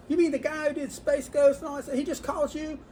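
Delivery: high-pitched, astonished questioning; imitated disbelief. Customers react to the second famous caller, mock astonished. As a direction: play the fan who can't believe his ears.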